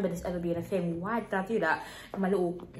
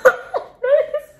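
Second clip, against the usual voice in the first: high squealing embarrassed laugh; 13 dB louder than usual. Real, not acted. Laughing at her childhood photo, a squealing laugh. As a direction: burst into a high shrieking laugh.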